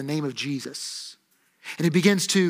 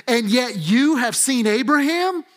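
higher-pitched acted disbelief; raised, emphatic mock incredulity; incredulous question; 5 semitones higher than usual. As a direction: question him as a doubting crowd. Crowd disbelieving Jesus, acted out.

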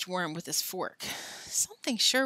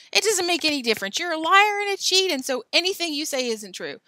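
fast, loud, higher accusing; angry rant; 9 semitones higher, 6 dB louder than usual. A narrator's reading. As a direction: rattle off the insult.

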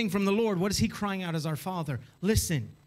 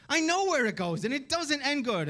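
high-pitched parental warning; pleading parent; 7 semitones higher than usual. Acted. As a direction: urgently warn your child from experience. Acted parent warning a child.

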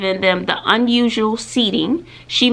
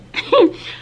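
breathy laugh. Real, not acted. Laughs after tearing up.

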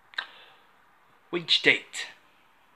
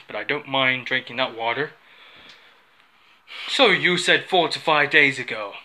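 ordinary talk, nothing marked

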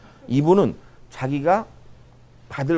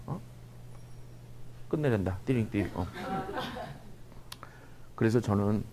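ordinary talk, nothing marked

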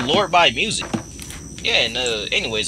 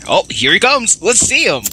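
excited; 9 semitones higher than usual. Real, not acted. Monster appears.

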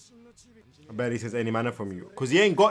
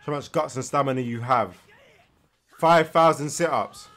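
usual speaking voice

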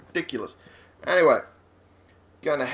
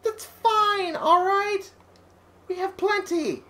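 high squeaky voice; slower than usual. Pretend. Mocking companion's complaint.

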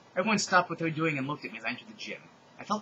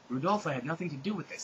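ordinary talk, nothing marked

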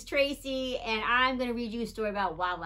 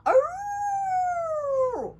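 high hammed-up howl, loud; mock wolf howl; 6 dB louder than usual. Howl sound in story.

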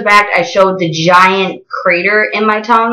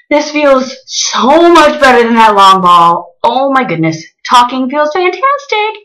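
excited; 5 semitones higher, 3 dB louder than usual. Real, not acted. Relief after changing piercing.

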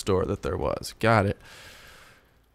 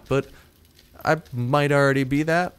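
ordinary talk, nothing marked

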